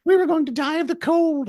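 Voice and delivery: very high, squeaky mock gratitude; hammed-up rescued kids; mock grateful kids. College kids at the vents thanking him, squeaky.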